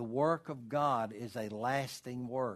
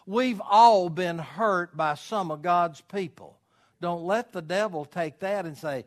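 louder, higher, hammering each phrase home; full-force preaching; 6 semitones higher, 7 dB louder than usual. Acted. Pastor preaching hard to his congregation about hurt, full force.

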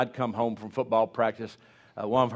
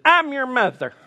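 much higher and louder, mock scandalised; shocked mother; 13 semitones higher, 10 dB louder than usual. Mother pulling away from the kiss, high scandalised yelp. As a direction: shriek it as an outraged mom.

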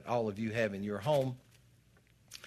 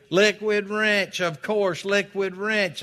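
excited recall; 10 semitones higher, 10 dB louder, slower than usual. Real, not acted. Remembers the product name.

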